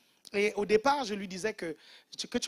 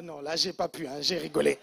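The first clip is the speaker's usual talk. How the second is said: usual speaking voice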